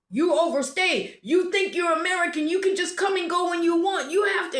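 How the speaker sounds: loud, high-pitched imitated tirade; berating. Border guard scolds an American traveler for overstaying, berating. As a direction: berate like an irate border official. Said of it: "he just started berating me".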